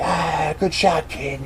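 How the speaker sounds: high, held-back cheer; mock scream. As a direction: cheer the kid on without real volume. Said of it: "they all screamed".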